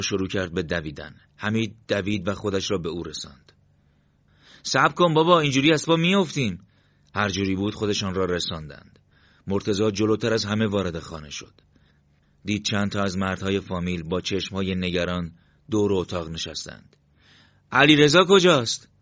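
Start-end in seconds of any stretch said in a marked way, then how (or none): 4.6-6.7 high, loud plea to wait; raised, urgent protest; calling after someone. Acted. Calling after running brother to wait. 17.7-18.9 raised, higher anxious question; loud, worried, pressing question; urgent demand. Acted. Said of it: "صدایش را بالا برد".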